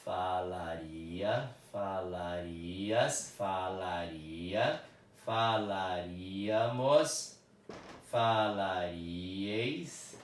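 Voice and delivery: each form drawn out slowly, syllable by syllable; slow, deliberate teacher dictation. Shown as a demonstration. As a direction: spell the conjugation out slowly for the class. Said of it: "vou adicionar aquelas desinências".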